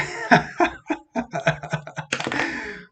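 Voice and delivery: long burst of helpless giggles; real laughter. Real, not acted. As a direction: crack up at an unexpected misprint. Teacher laughs at misprint. (carcajada larga y sincera)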